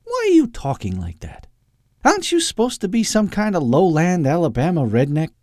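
very high, indignant challenge; pushy little sidekick. Acted. Topper questioning Doctor Loeb's accent, high pushy voice. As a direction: needle him in a high voice.

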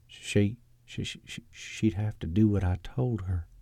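hushed, halting stammer on the first words; hesitant stammer. Acted. Eustace confesses his secret hope to Edwin, hesitantly. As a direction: stammer softly, then get it out. Nervous confession of revenge wish.

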